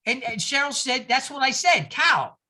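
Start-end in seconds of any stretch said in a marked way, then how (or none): 1.1-2.4 put-on Boston accent, Carl said as Cal; New England accent. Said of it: "they do not use the letter R".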